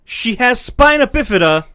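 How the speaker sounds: high, loud stress on "spina bifida"; incredulous emphasis. Real, not acted. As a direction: drive home "spina bifida" indignantly. Emphatic point of outrage.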